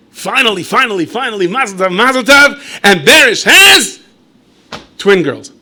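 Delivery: high, loud, slow drawn-out build to the twist; loud announcement. Acted. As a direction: shout the buildup, then land the punchline. Announcing twins.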